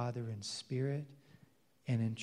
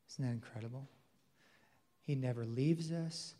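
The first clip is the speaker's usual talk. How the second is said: ordinary talk, nothing marked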